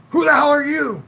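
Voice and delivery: high, loud angry coach. Retold or imitated. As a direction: bark it like an irate coach. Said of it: "He comes running over there".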